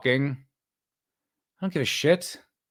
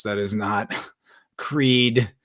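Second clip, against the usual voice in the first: whispered through a laugh, slow; barely voiced, breaking into a laugh; 6 semitones lower, much slower than usual. Real, not acted. Streamer laughing at a chat take, whispered and slow.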